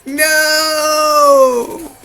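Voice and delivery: high wail building to loud drawn-out shouts; mock tantrum. Child freaking out in school hallway, building mock tantrum.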